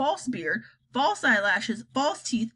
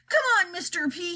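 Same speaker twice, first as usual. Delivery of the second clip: army chief pleading; 8 semitones higher than usual. Acted. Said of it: "he said".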